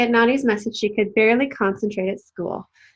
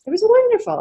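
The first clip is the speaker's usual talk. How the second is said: delighted; 13 semitones higher, 5 dB louder, faster than usual. Acted. Grandma delighted.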